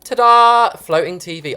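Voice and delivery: high, loud ta-da; ta-da reveal. Real, not acted. Builder revealing floating TV, triumphant ta-da. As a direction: present it like a magic trick.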